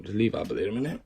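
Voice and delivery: ordinary talk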